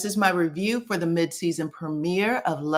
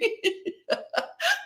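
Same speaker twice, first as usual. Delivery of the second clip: high laugh; 4 dB quieter than usual. Real, not acted. Laughing at nasty food.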